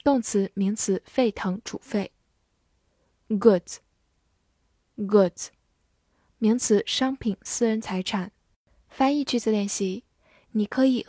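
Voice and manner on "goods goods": "Goods" said carefully, twice; careful model reading of "goods"; model pronunciation. Shown as a demonstration. Teacher modelling vocabulary for learners. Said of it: "vocabulary model reading".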